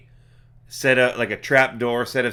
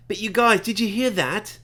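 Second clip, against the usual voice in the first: high-pitched character call, no real volume; mock yell; 9 semitones higher than usual. Gale alerting the party to a noise, mild high mock yell. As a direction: call out high without really shouting. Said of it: "and then Gale yells".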